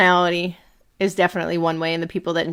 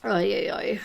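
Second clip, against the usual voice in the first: whispered oy; 5 dB quieter, slower than usual. Real, not acted. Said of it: "whispered sigh".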